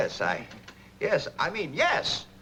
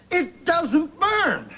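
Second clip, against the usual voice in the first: high, slow and louder, drawn-out joy; ecstatic realization; 12 semitones higher, 5 dB louder, much slower than usual. Acted. Boss to Dagwood, thrilled the paint really won't burn. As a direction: savour each word as the miracle sinks in.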